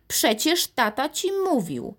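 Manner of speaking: high, scolding grandmother voice; huffing grandmother. A narrator's reading. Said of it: "zasapała babcia".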